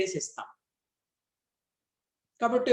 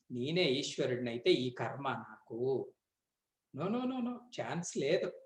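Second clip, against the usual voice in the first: soft, low mock protest; playful teasing guru; 6 semitones lower, 9 dB quieter than usual. Guru teasing the student by echoing his denial, soft and playful. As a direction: mimic the student's denial gently, hushed and playful. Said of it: "సరసం ఆడారట".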